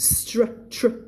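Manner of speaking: str- whispered, drilled on its own; drilling str. Shown as a demonstration. Teacher drilling the opening cluster, whispered demo. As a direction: hiss out str- without voice. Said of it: "เอาแค่ตรงนี้ก่อน".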